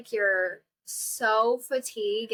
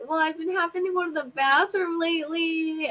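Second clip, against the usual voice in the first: high, whimpering, crying confession; embarrassed whimper; 6 semitones higher than usual. Retold or imitated. Admitting bathroom trouble to the hot doctor, teary and whimpering. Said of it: "like, tell him".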